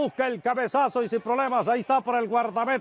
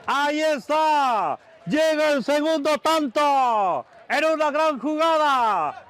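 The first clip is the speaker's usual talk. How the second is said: loud, higher, stretched-out goal recap; excited goal recap; 6 semitones higher, 3 dB louder, slower than usual. Acted. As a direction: keep shouting the replay with joy.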